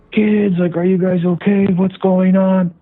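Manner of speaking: high, anxious parent calling out; worried parent calling. Retold or imitated. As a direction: call out like a worried mom. Parent voiced in a story.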